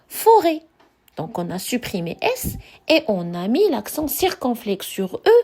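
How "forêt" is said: forêt said clearly, with an open ê; model word. Shown as a demonstration. Teacher revealing the modern word to the class. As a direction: land on forêt as the answer, with an open ê. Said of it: "est devenu".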